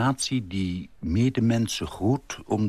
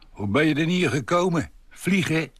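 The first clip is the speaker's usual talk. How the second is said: higher-pitched cheery Amsterdam porter; vrolijke Amsterdammer; 9 semitones higher than usual. Acted. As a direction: quip it like a jolly Amsterdammer. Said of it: "Een vrolijke Amsterdammer". (vrolijke Amsterdamse portier)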